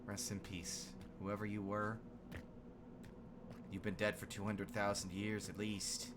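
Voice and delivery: hushed, low and reverent; quiet. Real, not acted. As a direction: speak softly to the dead. Respect for ancient dead.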